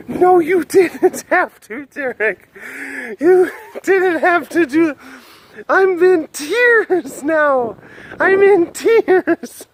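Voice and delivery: high, slow put-on voice of a wailing fan; mock heartbroken viewer. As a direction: play a hurt fan sobbing at Derek. Mock viewer scolding Derek.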